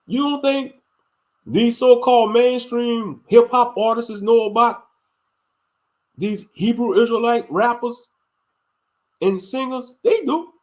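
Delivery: high and loud, pressing the question; fired-up preaching. Real, not acted. Preaching to viewers about rappers, fired-up high challenge. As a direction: challenge them, building to they do.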